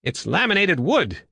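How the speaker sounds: high, sudden discovery; sudden remark. A narrator's reading. Woodbine peering inside the craft, sudden and high. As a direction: blurt it out with a lift in pitch. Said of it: "he said suddenly".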